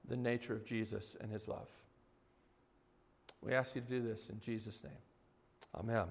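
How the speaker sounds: low, soft praying voice; prayer. Real, not acted. Pastor asking the Lord for help before the sermon, low hushed prayer. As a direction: pray quietly and earnestly, well below preaching pitch.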